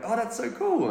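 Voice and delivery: high, gushing mock enthusiasm; put-on excited squeal of approval; impressed gushing. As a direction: imitate someone hugely impressed. Acted impressed reply to a job.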